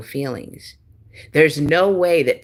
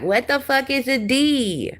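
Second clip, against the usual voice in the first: mock dumb question; 10 semitones higher than usual. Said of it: "You're like".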